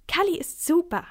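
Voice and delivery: high, loud keen praise; enthusiastic assurance. A narrator's reading. Boy convincing the man about his show, enthusiastic. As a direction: vouch for it eagerly. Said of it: "versichert Max".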